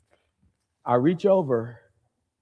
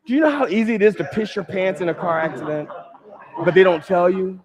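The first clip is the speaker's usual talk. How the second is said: louder, higher, indignant questions to the crowd; incredulous rant; 6 semitones higher, 4 dB louder than usual. Real, not acted. Comic ranting to the audience about insurance, indignant. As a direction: push it up, outraged disbelief.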